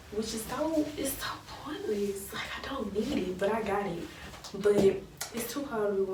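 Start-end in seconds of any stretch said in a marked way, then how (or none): 0.1-3.4 soft unvoiced whisper to the camera; whispered aside. Real, not acted. Telling viewers a little secret in a whisper. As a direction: let it slip out as a whisper. Aside about the useless bodysuit.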